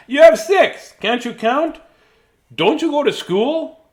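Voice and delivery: high, loud teasing voice; mock-scolding shopkeeper; mocking store man. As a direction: tease the moose, high and loud. Laughing man mocks Morris.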